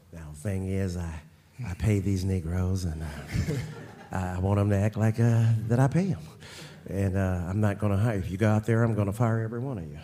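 mock Jerry Jones accent, only slightly voiced; imitating Jerry Jones. Host mocking Jerry Jones to the guest, light Texas accent. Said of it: "I was killing that accent right there".

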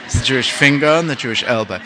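ordinary delivery

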